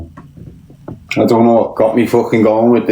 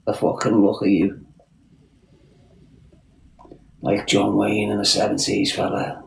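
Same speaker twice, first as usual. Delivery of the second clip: hushed mock admiration; 2 semitones lower, 8 dB quieter than usual. Hushed admiring of own look.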